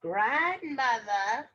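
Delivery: put-on wolf faking a little girl, drawn out; wolf's little-girl voice. Wolf tricks Grandmother at her door, fake girl voice. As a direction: call slowly in a put-on girl voice. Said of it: "in his best little girl voice".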